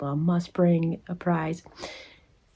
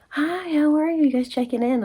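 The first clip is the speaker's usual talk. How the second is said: much higher, brighter customer-service greeting; mock cheery receptionist; 9 semitones higher, 4 dB louder than usual. Receptionist greets the waiting vendors, mock cheery and high. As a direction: chirp it like an oblivious receptionist.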